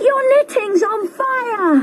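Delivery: high urgent warning; alarmed child call. A narrator's reading. Twins telling Grandma her knitting burns, high and urgent. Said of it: "shouted the twins".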